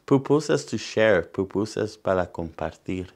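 lively bilingual picture-book reading; reading to children. Acted. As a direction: read it out to the kids, bright and clear. Reads picture book aloud.

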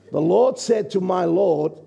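ordinary delivery

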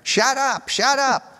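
high, yelled New York shout; New York accent. Retold or imitated. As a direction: bark it out like an angry New Yorker. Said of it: "in the best New York accent".

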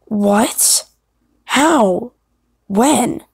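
lightly voiced warrior in disbelief. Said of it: "use your warrior voice".

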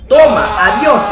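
loud, high triumphant yell; victory shout. Real, not acted. Gamer winning the match, shouting triumphantly.

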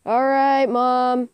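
calling for mom. Pretend.

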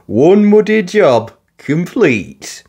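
proud little digger; beaming. Acted. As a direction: announce it with a grin. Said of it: "beamed Alfie".

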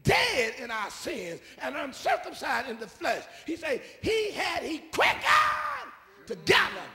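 slow, loud high scripture shout; preaching shout. Acted.

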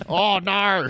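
high, loud Aussie "oh no"; exaggerated Australian accent. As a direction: drawl it out in broad Australian. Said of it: "it just is Australian as fuck".

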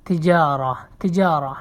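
তিজারা with the middle "jaa" drawn out long; long alif. Shown as a demonstration. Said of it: "একটু লম্বা করে ... বলতে হয়, লম্বা করে".

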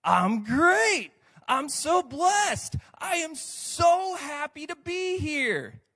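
high, loud, slow overjoyed gush; fake church happiness. Preacher hamming up the churchgoer's fake happy answer. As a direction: lay the fake joy on thick.

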